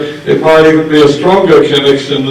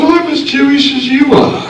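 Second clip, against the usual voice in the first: defiant retort; 13 semitones higher than usual. Retold or imitated. Defiant reply to professor.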